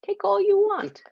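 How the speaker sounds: high, odd goblin squeak. Acted. Goblin voice in story.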